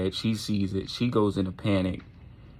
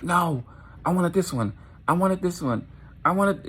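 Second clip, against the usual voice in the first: high, whiny, insistent protest; boiling tantrum; 10 semitones higher than usual. Acted. Tucker refuses to give up the squirrel, boiling high-pitched tantrum. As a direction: demand it like a thwarted kid. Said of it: "he's, he's boiling".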